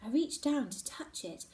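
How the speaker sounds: ordinary delivery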